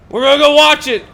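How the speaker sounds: ordinary talk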